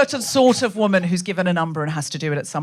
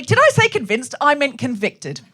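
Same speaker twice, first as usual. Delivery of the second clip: startled self-correction; 3 semitones higher than usual. Acted. Speaker catching their misread word, mock startled correction. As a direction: jump up in pitch as you catch the slip. Acts out catching one's own slip.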